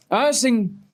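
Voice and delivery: put-on high, insistent drunk-girl voice. Drunk girl requesting a song from the band, high mock-drunk voice. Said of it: "a hundred percent what she sounded like".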